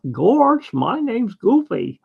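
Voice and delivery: high, goofy cartoon voice with chuckles. Shown as a demonstration. Showing viewers a cartoon character's voice, high goofy impression. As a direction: stretch it out, high and dopey, chuckling at both ends. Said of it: "he kinda has this kind of voice".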